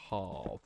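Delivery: "half" with a long ah vowel; Pommy pronunciation. Shown as a demonstration. Streamer telling chat own way, "half" with long ah. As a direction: say "half" as hahf. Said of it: "the Pommy way".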